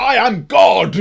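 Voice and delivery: huge, high, booming god voice; booming arrogant alien overlord. Acted. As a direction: proclaim yourself a god. Mocking journalist as all-powerful.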